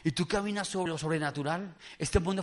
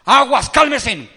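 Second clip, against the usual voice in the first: very loud, slow, higher command; commanding shout; 5 semitones higher, 13 dB louder, slower than usual. Acted. Preacher acts Jesus stilling the storm, commanding shout. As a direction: order the sea to obey.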